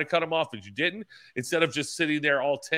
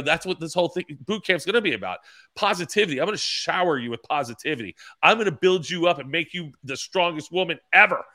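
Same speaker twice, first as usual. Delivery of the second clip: raised-pitch motivational hype; over-the-top ego; 5 semitones higher, 4 dB louder than usual. Retold or imitated. Racer pitching his anxiety boot camp, over-the-top ego. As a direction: pump them up like a self-styled guru. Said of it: "over the top ego".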